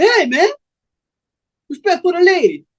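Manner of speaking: put-on high-pitched young dude standing up for his date; young man objecting. Young man defending the lady to Cold Blood, high-pitched objection. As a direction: protest up high, demanding respect.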